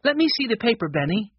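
mildly urgent demand, starting high; urgent cry. A narrator's reading. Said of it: "Jessie cried".

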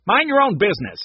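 acted cross outburst; snapping. A narrator's reading. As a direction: snap at a nosy child. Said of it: "Mac snapped".

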